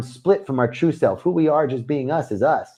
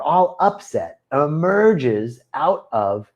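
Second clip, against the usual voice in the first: ordinary delivery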